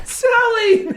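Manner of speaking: high laughing wail; mock grief cry. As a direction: cry out for the lost bee. Mourns a named bee.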